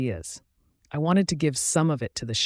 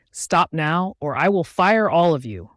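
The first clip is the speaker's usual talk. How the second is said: loud, forceful boss's order; hollered threat; 3 semitones higher, 6 dB louder than usual. A narrator's reading. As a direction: holler it to stop a fight. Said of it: "I hollered loudly".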